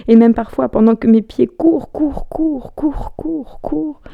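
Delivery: high, insistent repetition that gradually softens; frantic chase rhythm, fading at the top; running frantically. Acted. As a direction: start loud, climb high and chant the repeats. Breathless chase in the monologue.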